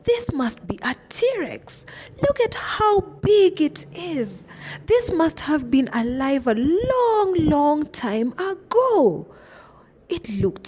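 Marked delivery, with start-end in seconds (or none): 0.0-9.3 excited exclaiming. A narrator's reading. Anna marvelling at the T-Rex to her friends. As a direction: marvel out loud at it. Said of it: "Anna exclaimed".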